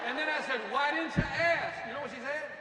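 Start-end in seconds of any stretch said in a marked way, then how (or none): 0.7-1.9 exasperated. Retold or imitated. Exasperated husband retelling quarrel.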